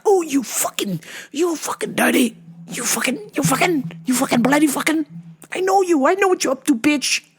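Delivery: high, sputtering comic accusation; mock anger. Teller knows what she's up to, sputtering mock anger. Own suspicious reaction retold.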